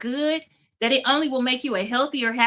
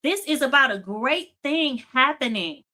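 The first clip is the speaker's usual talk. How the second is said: ordinary talk, nothing marked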